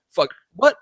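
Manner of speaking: sudden loud startled cry; retold panic. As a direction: blurt it out, startled. Retelling choking panic.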